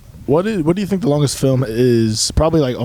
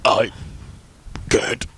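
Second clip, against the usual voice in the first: burp-talking; 4 semitones lower, 7 dB quieter, much slower than usual. Retold or imitated. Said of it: "He's, like, burping talk".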